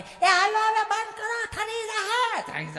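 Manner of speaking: put-on high, slow call of an old mother; mother calling back. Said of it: "તો બા કે".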